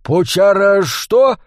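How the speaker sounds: slow, high, incredulous question; baffled surprise. A narrator's reading. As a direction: repeat the unknown word in bafflement. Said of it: "удивился Джо".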